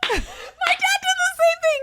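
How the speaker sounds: loud, very high shout through laughter; real laughing outburst, stretched out; excited recognition. Real, not acted. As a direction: shriek it out in delighted recognition.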